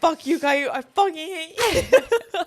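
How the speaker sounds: hammed-up squealed outburst, laughing; mock yelling. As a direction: imitate a drunk friend yelling, stretching the words. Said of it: "she's yelling at this guy".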